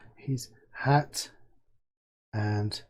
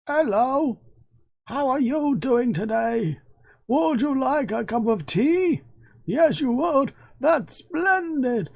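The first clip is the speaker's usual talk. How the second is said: NPC voice; 10 semitones higher, slower than usual. Acted. Voicing a game NPC's greeting.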